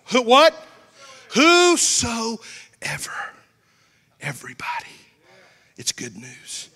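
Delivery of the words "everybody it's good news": sudden unvoiced whisper. Real, not acted. Preacher whispering to the congregation. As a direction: drop to a hushed whisper for emphasis.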